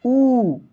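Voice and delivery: emphatic ऊ; forceful ऊ. Shown as a demonstration. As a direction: push on ऊ. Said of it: "जोड़ लगा के".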